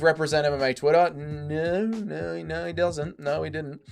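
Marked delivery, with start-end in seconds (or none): none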